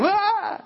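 sudden high yelp of fright; startled yelp. Retold or imitated. Preacher recalling snake bite, a high startled yelp.

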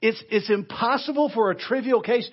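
mock admiration, pitched high; sarcastic rebuke. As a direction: heap on false praise with a sting. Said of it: "Very sarcastic, very stinging rebuke by Paul".

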